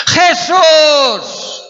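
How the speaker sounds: very high, loud, drawn-out cry; desperate shout. Acted. Blind man calling Jesus as he passes, desperate shout. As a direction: yell the name with all you have. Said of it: "A gritar".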